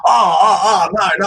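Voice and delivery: high, loud "oh no" backpedal; mock flustered protest. Imitating the burned man at the pub, loud mock protest. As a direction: blurt it out like someone caught out. Acting out the burned man's reply.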